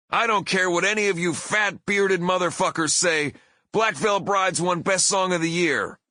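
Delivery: high, pushed heel rant; pro wrestler bellow. Retold or imitated. Singer defying the angry audience after winning, wrestler bellow. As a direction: bellow defiance over the boos. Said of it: "bellowed in my best professional wrestler voice".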